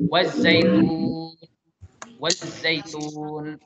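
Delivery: "waz-zaitun" slow, with the z doubled; correct reading. Shown as a demonstration. Teacher corrects the pupils' reading, slow and clear. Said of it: "bukan wal zaitun tapi waz-zaitun".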